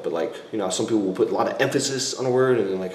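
usual speaking voice

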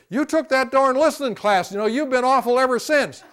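high, loud grumbling accusation; hammed-up angry spouse; mock complaint. Shown as a demonstration. Angry partner blaming the listening class, high and loud.

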